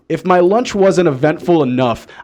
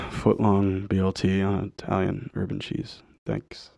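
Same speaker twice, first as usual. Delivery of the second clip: defeated mumble; 12 semitones lower, 12 dB quieter than usual. Retold or imitated. Weary order after sighing.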